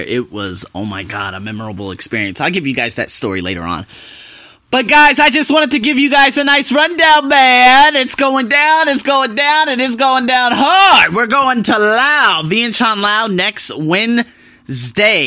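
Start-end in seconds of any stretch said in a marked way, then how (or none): 4.7-15.3 much higher and louder, pumped; rally the audience, building hype; hyped announcement. Real, not acted. Vlogger hyping his Laos trip to viewers, excited.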